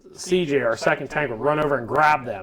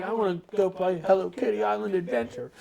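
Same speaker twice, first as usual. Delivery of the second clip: put-on soft, higher, childlike wish; Butters voice; 5 semitones higher, 6 dB quieter, faster than usual. Teammate imitated as Butters, softer childlike voice. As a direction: say it like a sweet naive boy. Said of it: "C J even acts like Butters".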